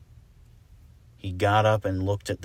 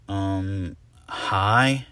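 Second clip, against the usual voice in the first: awkward; 5 dB louder than usual. Acted. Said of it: "said Argyle awkwardly".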